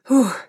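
short sighing exhale; exhaled "huh". A narrator's reading. Caroline flustered by Simon, exhaling a huff. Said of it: "vydechla jsem znovu".

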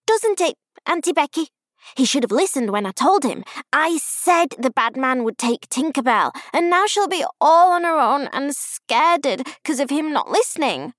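put-on high, loud child voice; indignant little girl. A narrator's reading. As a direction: grumble urgently like an aggrieved five-year-old. Indignant child complains to aunt.

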